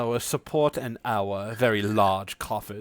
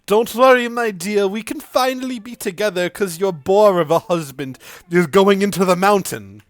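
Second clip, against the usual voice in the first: higher mock romantic promise; retold suitor; 5 semitones higher, 3 dB louder than usual. Mother retells Aaron's romantic promise in a higher mock voice. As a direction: croon like a lover. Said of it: "He said".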